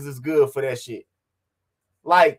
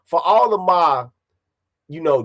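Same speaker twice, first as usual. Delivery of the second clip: ordinary talk, nothing marked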